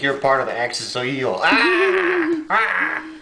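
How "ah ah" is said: very high, loud held "ah" cries; two loud high-pitched screams. Real, not acted. Playing with a sharp-fanged puppy, screaming.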